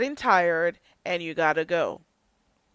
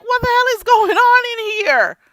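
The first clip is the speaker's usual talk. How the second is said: disbelieving shout; 13 semitones higher, 7 dB louder than usual. Retold or imitated. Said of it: "I'm like".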